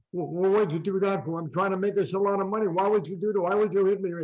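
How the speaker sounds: high, stammering hurt complaint; whiny protest. Retold or imitated. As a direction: sound wounded and indignant. Ali whining after being slapped.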